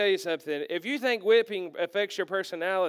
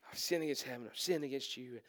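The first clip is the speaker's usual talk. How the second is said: rushed, soft and lower, with a nervous laugh at the start; nervous blurted confession; 8 semitones lower, 13 dB quieter than usual. Retold or imitated. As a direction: get the rehearsed speech out fast before you lose your nerve. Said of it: "And he said".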